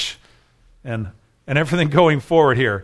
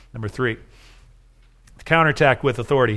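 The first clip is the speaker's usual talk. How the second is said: ordinary delivery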